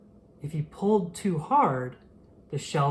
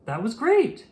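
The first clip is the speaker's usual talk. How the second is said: higher and louder, thrilled; delighted little fish; 7 semitones higher, 4 dB louder than usual. A narrator's reading. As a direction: cheer it like a small fish amazed. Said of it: "Shrimpy said".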